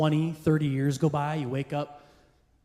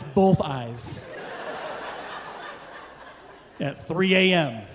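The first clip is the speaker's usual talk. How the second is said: slow punchline; much slower than usual. Real, not acted. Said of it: "drawn-out punchline".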